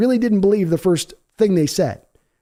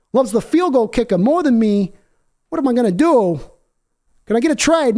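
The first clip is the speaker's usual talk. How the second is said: a raised, whiny football-star voice, lightly put on; mock hurt quarterback; 6 semitones higher than usual. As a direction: play the sulking star quarterback, feeling unloved.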